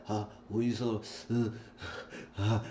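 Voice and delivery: soft, broken words between mock moans; distracted, moaning phone voice; imitated moaning. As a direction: trail off quietly into pretend moans. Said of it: "He was just moaning".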